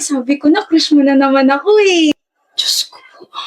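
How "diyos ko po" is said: breathy whisper of disbelief under the breath; whispered exasperation. Acted. Girl exasperated by her classmate, whispered under breath. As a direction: mutter it to yourself, barely voiced.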